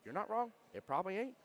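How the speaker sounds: high, loud mock concession; playful, high-pitched retold retort; cheerful comeback.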